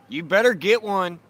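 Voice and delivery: loud, high teasing holler; playful yelling. Real, not acted. Teasing his friend about buying a gel blaster, loud playful holler. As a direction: yell a joking threat across the street.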